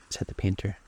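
ordinary delivery

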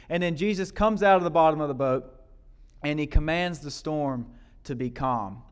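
ordinary delivery

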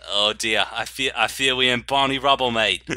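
comic Cockney rhyming-slang patter; mock Cockney accent. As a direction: lament it in a put-on Cockney accent. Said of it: "entire episode in Cockney accents".

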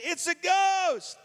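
high, slow cry of terror; terrified cry. Acted. Disciples in the boat shrieking in terror. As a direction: shriek it like you saw a ghost.

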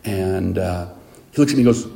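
ordinary talk, nothing marked

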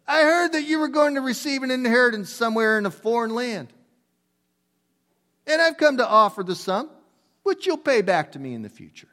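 high, earnest rabbi voice offering money; acting out the rabbi. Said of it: "I gotta do this".

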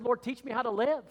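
fervent prayer. Retold or imitated. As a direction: cry out to God from the heart. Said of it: "I said".

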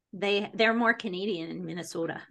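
normal talking voice

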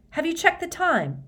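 high call across a room. A narrator's reading. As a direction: call out from far away. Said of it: "Hermione called".

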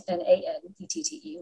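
N A N E T T E, each letter said apart; spelling it out. Shown as a demonstration. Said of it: "but it's Nanette".

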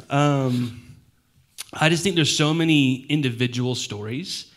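ordinary talk, nothing marked

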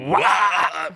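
too-high wah attempt. Shown as a demonstration. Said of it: "I keep trying to hit high".

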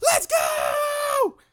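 high, loud cheer; squealing hype shout. Real, not acted. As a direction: yell it like a fan.

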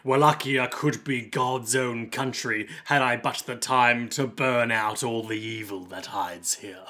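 low, slow, brooding villain; drawn-out, ominous monologue; pious villain. Acted. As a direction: intone a grandiose churchman's lament. Acts the pious villain's speech.

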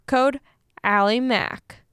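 ordinary talk

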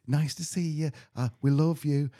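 lightly imitated friend on video; Natalie. Friend starting a video message, lightly imitated greeting. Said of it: "started with Natalie going".

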